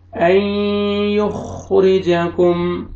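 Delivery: "युखरिजाकुम" slow, noon merged into ya with a held nasal hum; slow tajweed recitation with ghunna; nasal merge. Shown as a demonstration. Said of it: "इदगाम करना है गुन्ना के साथ".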